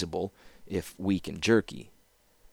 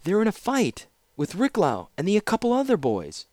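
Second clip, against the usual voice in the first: put-on breathless young girl's cry, much higher; urgent call; 13 semitones higher than usual. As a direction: run up calling out in alarm. Student reports fight in alarm.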